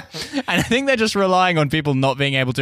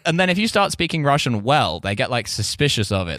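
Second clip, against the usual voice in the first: ordinary delivery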